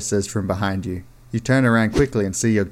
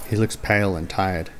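ordinary delivery

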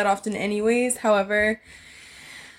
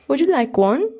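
higher-pitched mock French accent; bad French accent; 5 semitones higher than usual. Waiter offering his own cigarettes, in a bad French accent. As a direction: imitate a French waiter with a badly faked accent. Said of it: "That was my bad French accent".

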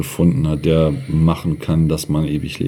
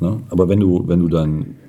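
ordinary talk, nothing marked

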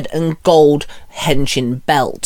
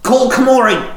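calling out; 9 semitones higher than usual. A narrator's reading. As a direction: yell the order mid-fight. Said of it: "Shinzo called out".

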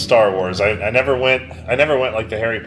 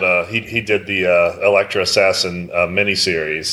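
usual speaking voice

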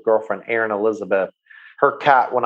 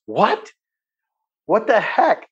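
higher-pitched mock bewilderment; baffled disbelief; 9 semitones higher, slower than usual. Retelling dog owner's reaction to the whining, baffled disbelief. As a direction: act out being stumped, pitch lifting on each question.

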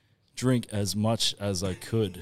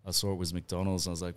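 ordinary delivery

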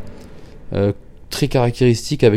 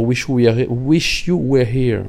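careful slow English accent on the song title; title drawn out in English; slower than usual. Real, not acted. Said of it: "excusez-moi mon accent". (titre lu lentement avec l'accent anglais)

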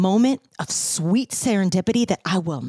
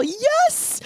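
a very high, thrilled "Yes"; delighted yes. Retold or imitated. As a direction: squeak the yes out in pure delight. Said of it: "To which I say".

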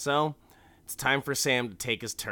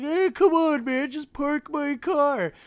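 pitched way up, pleading; high whiny valet; 5 dB louder than usual. Retold or imitated. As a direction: squeak it like a pushy valet. Valet voiced in retold scene.

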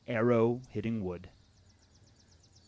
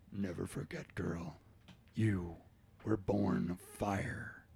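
much softer, low and slow, otherworldly; eerie inner voice; 3 semitones lower, 4 dB quieter, slower than usual. Acted. Mysterious voice speaks inside Barb after the stone hits, hushed and slow. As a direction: let it well up from deep inside, hushed.

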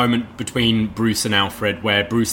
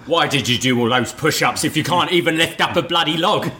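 raised Cockney butler voice; Alfred's Cockney accent; 4 semitones higher, faster than usual. Retold or imitated. Alfred chiding trapped Bruce, in a Cockney accent. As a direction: scold him in a put-on Cockney accent. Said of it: "I love his accent".

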